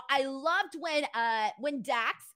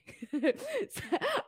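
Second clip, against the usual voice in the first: embarrassed laugh; 4 semitones higher than usual. Real, not acted. Caught out by a chat comment.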